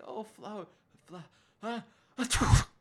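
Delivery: high voice breaking up into a loud fake sneeze; acted allergy attack, gasping before the sneeze; mock sneezing fit. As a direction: build to an exaggerated sneeze mid-word. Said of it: "breaking out in sneezing fits".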